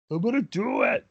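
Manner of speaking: high, hyped-up wrestler shout. Acted. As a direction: play the wrestler psyching himself up. Voicing wrestler going for table.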